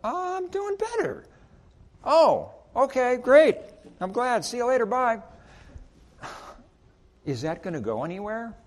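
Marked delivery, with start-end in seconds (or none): 0.0-1.2 much higher, airy put-on voice; hammed-up cheerful customer; mock customer. 2.0-5.2 hammed-up chipper farewell; upbeat goodbye. Quick goodbye retold.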